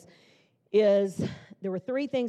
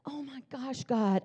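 hushed, higher, as if about to cry; mock tearful awe; 5 semitones higher, 4 dB quieter, slower than usual. Speaker imagines herself crying at answered prayer, mock tearful. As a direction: play at being softly overcome. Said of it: "you would think that I would start crying".

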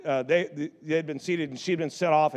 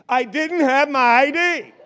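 higher, louder, slowed excuse; mock scream of grandma; 9 semitones higher, 7 dB louder, slower than usual. Grandma screaming her excuse at the narrator, higher and louder. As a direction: yell the excuse at a stranger like a flustered grandma. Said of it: "she screams it at me".

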